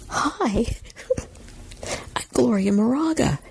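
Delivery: high, sweet self-introduction; put-on charming hello, high-voiced; really nice greeting. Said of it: "saying my name really nicely".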